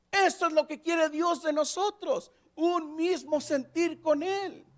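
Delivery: fervent sermon climax; impassioned preaching. Acted. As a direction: preach it out with rising fervor. Preaching at full force.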